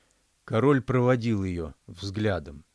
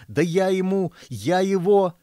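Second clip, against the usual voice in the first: 1 sputtering rage; 8 semitones higher, 6 dB louder than usual. A narrator's reading.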